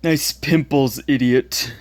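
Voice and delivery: taunting schoolyard bully voice; mocking bully. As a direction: sneer it like a bully. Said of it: "the ones that I, I quoted".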